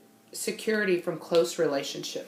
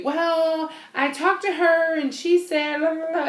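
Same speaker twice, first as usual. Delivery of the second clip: rambling chatter; 8 semitones higher, 3 dB louder than usual. Retold or imitated. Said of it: "It used to be".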